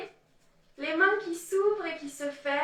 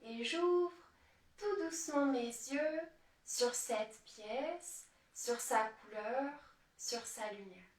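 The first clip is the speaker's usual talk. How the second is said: soft, slow meditation voice sinking lower; guided meditation; 3 semitones lower, 9 dB quieter than usual. Acted. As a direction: ease them gently out of meditation, with long pauses.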